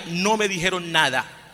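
ordinary talk

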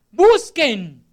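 loud one-word cry; forceful, ringing imperative; shouted command. Retold or imitated. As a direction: shout it as an urgent order. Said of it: "porque el Señor grita".

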